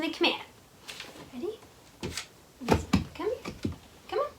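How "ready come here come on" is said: usual speaking voice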